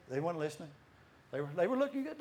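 normal talking voice

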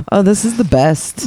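ordinary delivery

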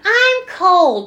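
high, loud mimicked complaint; mock complaint.

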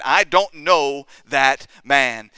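each word slow and weighted in firm denial; emphatic denial. Retold or imitated. Preacher quotes Peter disowning Jesus, emphatic denial. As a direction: stretch out "know that man", word by word. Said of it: "He simply referred to him as that man".

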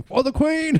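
high mock battle cry; patriotic cry. Mocking himself shouting for the Queen, high and hammed-up. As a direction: cry it out like a loyal soldier.

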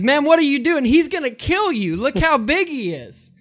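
put-on a frightened young bull warning his buddy; panicked bull.

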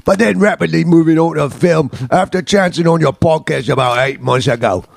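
put-on British accent; mock English accent, letter reading. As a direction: read the listener's letter as a Brit. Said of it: "I get to sound like [name] again".

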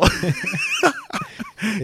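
high shouted burst of laughter; real laughter at the fan story; big laugh. Real, not acted.

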